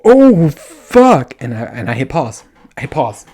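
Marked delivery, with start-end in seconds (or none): 0.0-1.3 high, loud mock moan of climax; imitated moan. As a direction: ham up the guy's climax groan. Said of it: "He goes like this".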